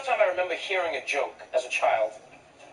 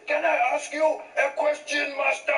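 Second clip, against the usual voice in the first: grandfather's voice; 12 semitones higher than usual. Acted. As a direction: call out deferentially as an old grandfather. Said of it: "He said".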